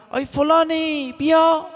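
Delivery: high, drawn-out holler to someone; slow, high-pitched shout-out; demonstrated calling out. Shown as a demonstration. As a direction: call someone over from far away. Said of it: "آقا، داد زدن حرامه؟".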